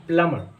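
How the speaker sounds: "प्लमर" with silent b; correct pronunciation. Shown as a demonstration. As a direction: repeat "प्लमर" with only an m in the middle. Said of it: "इसमें बी silent होता है".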